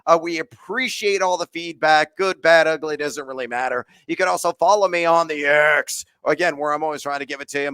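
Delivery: usual speaking voice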